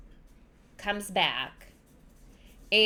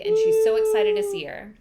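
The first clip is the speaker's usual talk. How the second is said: excited; 13 semitones higher, 7 dB louder than usual. Retold or imitated. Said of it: "she's been missing her so badly".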